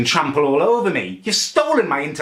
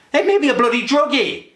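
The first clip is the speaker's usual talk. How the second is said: high, louder indignant blast; furious outburst; 12 semitones higher, 3 dB louder than usual. Real, not acted. Ranting about family drugging him, furious outburst. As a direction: spit out "druggie" in outrage.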